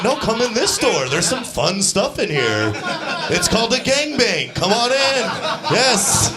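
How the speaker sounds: a bad friend tempting him into evil; tempting corrupter. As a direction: lure a kid through the door. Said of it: "Just kinda wanted".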